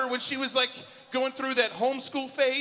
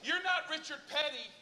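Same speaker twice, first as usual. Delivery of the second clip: mock yell; 7 semitones higher, 5 dB quieter than usual. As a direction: imitate yelling at your kid without full volume. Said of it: "I yelled at her".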